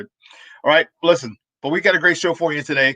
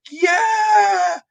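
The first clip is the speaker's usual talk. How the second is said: long, high-pitched cheering yell; stretched-out, high "yeah" cheer; crowd going crazy. Retold or imitated. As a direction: imitate a crowd erupting on a big catch. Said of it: "The crowd goes crazy".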